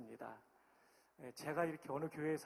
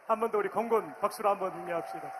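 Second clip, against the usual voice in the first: loud, fast cheerleading call to the crowd; rallying call; 3 semitones higher, 9 dB louder, faster than usual. Real, not acted. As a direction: fire up the room for applause. Rallying applause for air force.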